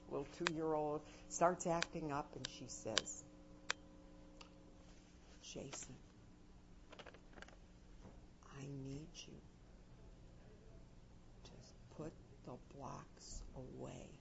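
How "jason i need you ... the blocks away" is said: very soft, with long pauses between the words; calm and low, let each word land alone; soft slow pauses. Shown as a demonstration. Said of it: "did you notice how I paused?".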